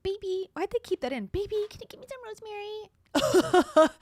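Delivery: high-pitched mimicry of the wife; mocking imitation. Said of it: "She's like".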